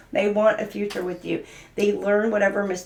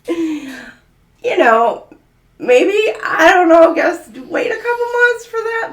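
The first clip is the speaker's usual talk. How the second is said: laughing; 9 semitones higher, 8 dB louder, slower than usual. Real, not acted. Laughing at a stranger's proposal.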